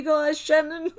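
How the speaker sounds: high, loud, tearful cheer; crying. Real, not acted. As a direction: read it out as tears take over. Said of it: "people crying with me".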